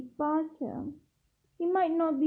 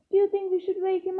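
higher, hesitant-sounding question; mildly awkward, voice lifted; 6 semitones higher, faster than usual. A narrator's reading. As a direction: ask it a little awkwardly, pitched up. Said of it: "Ron asked awkwardly".

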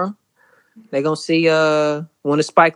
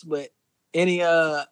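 ordinary talk, nothing marked

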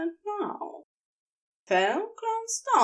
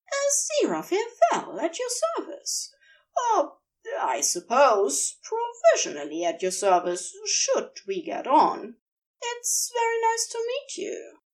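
lightly posh, polite and hopeful; refined gentleman offering his service; 10 semitones higher than usual. A narrator's reading. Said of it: "a posh voice said".

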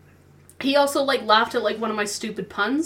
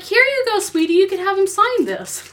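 higher, lightly sweet tone; sweet girlfriend voice; 10 semitones higher, 5 dB louder than usual. Retold or imitated. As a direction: hand it over kindly, a bit higher. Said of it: "she's like".

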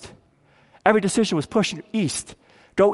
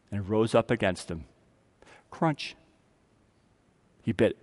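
hushed, dramatic; 10 semitones lower, 9 dB quieter than usual. A narrator's reading. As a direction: lower and softer, almost under the breath. Dramatic climax of Cain's attack.

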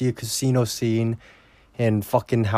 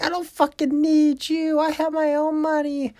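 much higher mocking woman's voice; mock Ginger. Ginger telling Joe Pesci off, mocking high voice. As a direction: sneer it in a shrill mocking falsetto. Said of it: "she's like".

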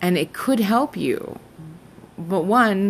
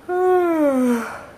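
long theatrical sigh, pitched up high; dramatic high sigh; 11 semitones higher than usual. Acted. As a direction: heave a big campy sigh. Theatrical sigh after intro.

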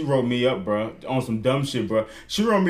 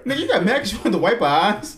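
laughing; 6 semitones higher than usual. Real, not acted. Mocking the man who walked out.

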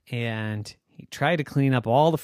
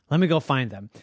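ordinary delivery

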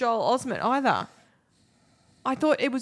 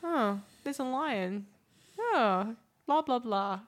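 bored; 4 dB quieter, slower than usual. Retold or imitated. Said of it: "In this film, I kinda went".

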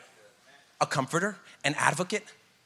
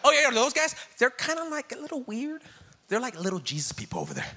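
mock gossip; 5 semitones higher than usual. Mocking outsiders' gossip.

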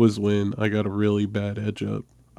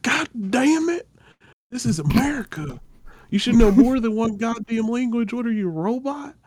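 mock angry aunt; 13 semitones higher, 3 dB louder than usual. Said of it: "she was pissed".

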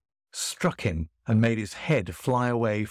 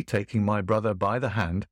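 plain reading voice

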